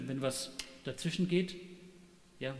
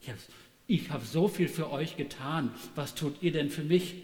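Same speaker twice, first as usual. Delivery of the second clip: ordinary talk, nothing marked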